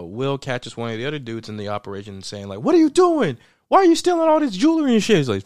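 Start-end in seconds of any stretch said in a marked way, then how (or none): 2.6-5.2 high, loud, fast telling-off; mock scolding. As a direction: scold him in a high, rushed mock yell. Acted scolding of a fellow robber.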